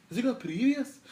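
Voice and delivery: very high, loud mock Cockney; terrible Cockney accent. Mimicking The Bill for the viewers, terrible Cockney accent. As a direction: belt out the question in a hammed-up Cockney accent. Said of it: "that's just my terrible accent".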